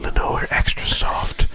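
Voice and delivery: slow whispered advert voice; washing up advert whisper. Retold or imitated. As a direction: hush it like a fabric softener ad. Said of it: "You sound like every washing up advert".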